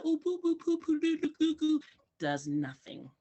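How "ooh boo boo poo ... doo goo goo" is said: high, sing-song nonsense cooing; mimicked baby talk. Teacher mocks relatives cooing at babies, high baby talk. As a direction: coo mockingly in a raised pitch. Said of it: "the way I'm talking to you".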